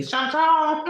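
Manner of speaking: sharp call to the pet. Real, not acted. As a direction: call her name up high like catching a pet in the act. Calling out to her pet.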